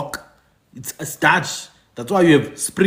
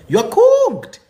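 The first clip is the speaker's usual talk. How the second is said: much higher and louder, mock-alarmed; incredulous exclamation; 12 semitones higher, 4 dB louder, slower than usual. Real, not acted. Calling out the king for European names, loud disbelief. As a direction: shout the verdict in disbelief.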